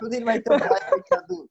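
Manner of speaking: loud, high mock Chittagong accent turning "সুধীর ভাই" toward "ছুদির ভাই"; teacher mimics a Chittagong man calling out "সুধীর ভাই", loud mock Chittagong accent. Chittagong fan hailing Sudhir, playful high Chittagong accent. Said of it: "এখন সে তো চট্টগ্রামের ভাষায় উচ্চারণ করছে".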